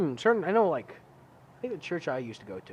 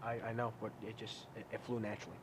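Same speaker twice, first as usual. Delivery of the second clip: sheepish mumble; 4 semitones lower, 12 dB quieter than usual. Real, not acted. Sheepish reply after "you can say fuck.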